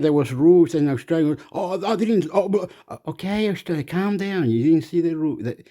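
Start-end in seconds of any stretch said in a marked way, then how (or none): 1.5-5.4 high-pitched mock pleading; raised, hammed-up protest; mock flustered. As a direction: play a flustered go-between calming someone down. Acts out calming flustered Australia.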